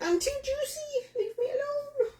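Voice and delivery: high comic whimper; whiny high plea. Retold or imitated. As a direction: squeal it like a pestered victim. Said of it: "I'm like".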